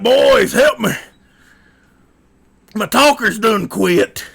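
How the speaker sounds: high, loud lumberjack holler; mock desperate cry. Lumberjack calling the boys as his voice quits, mock desperate holler. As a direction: yell for help in hammed-up panic. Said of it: "I'll be so hoarse I can't talk".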